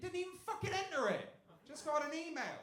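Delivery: loud, high disbelief; outraged rant. Real, not acted. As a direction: rant about the unfairness. Outraged at mate's luck.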